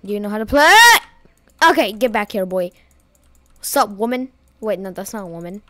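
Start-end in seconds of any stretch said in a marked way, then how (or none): none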